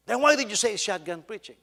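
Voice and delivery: retold outburst, loud and high; angry confrontation. Offended pastor confronts the man who marked his outline, angry and loud.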